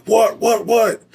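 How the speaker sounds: high, panicky repeated what; imitating scared buddy. Buddy hits the dash, mock frightened high-pitched what. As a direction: squeak the whats out in a mock startled panic. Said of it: "man, he got scared".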